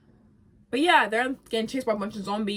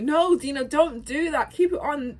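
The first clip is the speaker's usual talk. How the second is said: higher, louder urgent plea as Ellie; imitating Ellie; 7 semitones higher, 7 dB louder than usual. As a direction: beg her to keep the mask on, raised voice. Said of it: "Ellie's like".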